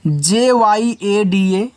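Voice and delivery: slow, deliberate letter-by-letter spelling; letters spelled out slowly. Shown as a demonstration. Teacher spelling an English word for children, slowly. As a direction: spell each letter apart for the class. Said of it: "teacher spells word letter by letter".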